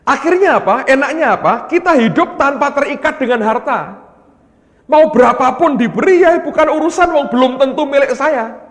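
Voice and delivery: loud, high, ringing preaching; preaching at full force. Acted. Preacher urging the congregation not to cling to wealth, loud and high. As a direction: drive the point home to the congregation.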